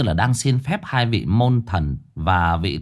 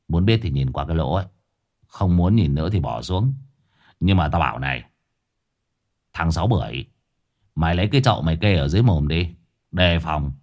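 put-on lower, quick old man's instructions; old exorcist instructing; 6 semitones lower, faster than usual. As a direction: give brisk orders in a low voice. (giọng ông thầy già trầm, dặn dò)

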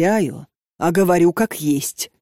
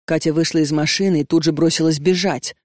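usual speaking voice

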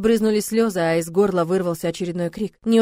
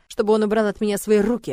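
ordinary delivery

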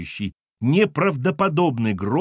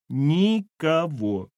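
very slow, higher-pitched tormented denial; agonized; 4 semitones higher, much slower than usual. Acted. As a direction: drag the word out painfully. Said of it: "мучительно выдавила она".

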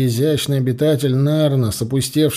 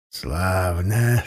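gloomy sarcasm; 6 semitones lower, 4 dB quieter than usual. Acted. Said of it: "угрюмо бросил Прат".

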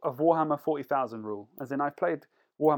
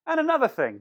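mock rant; 10 semitones higher, 5 dB louder than usual.

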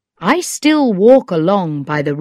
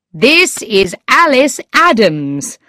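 raised, slow announcement; mild exclamation in a higher voice; crying out; 6 semitones higher, 4 dB louder than usual. A narrator's reading. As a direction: exclaim it emphatically, drawn out. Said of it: "I cried".

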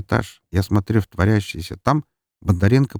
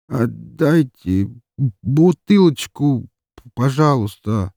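very slow, halting, higher-pitched plea; polite, stammering drunk; 5 semitones higher, 3 dB louder, much slower than usual. A narrator's reading. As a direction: stumble politely through it, tipsy. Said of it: "очень вежливо, запинаясь, попросил".

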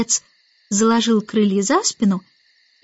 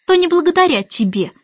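higher, cutting comeback; curt retort; 7 semitones higher, 3 dB louder than usual. A narrator's reading. Mary Poppins puts the starling down, curt retort. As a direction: cut him off tartly. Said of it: "отрезала Мэри Поппинс".

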